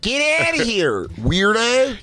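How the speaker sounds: high, drawn-out mock Philly jeer; Philly accent. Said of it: "whiff of a Philly accent".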